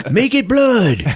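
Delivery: high, urgent command; mock director's order. Director fixing the credits, mock urgent shout. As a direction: call it out high like an alarmed director.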